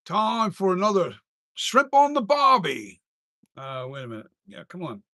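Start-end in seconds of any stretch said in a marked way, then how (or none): 0.1-3.0 mock Australian accent. Streamer recalling his Australian accent for viewers, hammed-up. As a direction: stretch out the Aussie line, up high.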